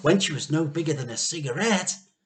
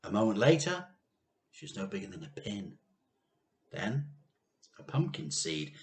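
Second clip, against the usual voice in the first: usual speaking voice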